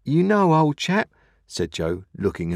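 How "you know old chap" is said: high, louder, earnest appeal; earnest remonstrance. A narrator's reading. Joe addressing Pip, not Missus Joe, in earnest remonstrance. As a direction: appeal to a pal in a pitched-up voice.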